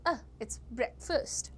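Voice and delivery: a as a short uh, breakfast as BREK-fust; reduced short vowel. Shown as a demonstration. Teacher showing the right vowel, short a in breakfast. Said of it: "The A becomes a".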